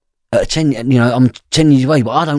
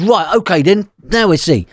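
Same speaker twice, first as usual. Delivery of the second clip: higher, louder mock-defiant dare; defiant challenge; 8 semitones higher, 5 dB louder than usual. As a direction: throw down a cocky challenge. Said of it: "I said".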